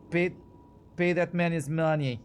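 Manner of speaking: bad Russian accent. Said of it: "John Malkovich's bad accent".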